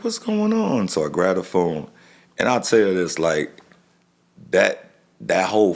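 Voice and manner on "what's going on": much higher, puzzled what's going on; sudden alarm at an early call; startled question. Retold or imitated. He wonders why his dad is calling so early, voice jumping higher.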